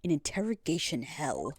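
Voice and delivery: low, solemn threatening voice; menacing priestess. Acted. Prosecutor's menacing speech.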